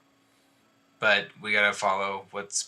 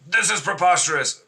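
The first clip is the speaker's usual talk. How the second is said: higher, blustering protest; fussing indignantly; 8 semitones higher, 4 dB louder than usual. Acted. Barnabas disputing a prisoner's claimed legal right, fussing indignantly. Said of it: "As he's fussing".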